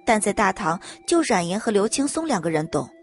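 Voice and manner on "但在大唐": ordinary delivery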